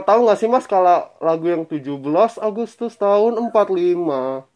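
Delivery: ordinary delivery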